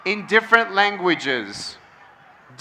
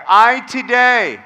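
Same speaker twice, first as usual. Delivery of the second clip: call and response affirmation. Shown as a demonstration. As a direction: lead the room through a pledge, phrase by phrase. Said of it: "Repeat after me".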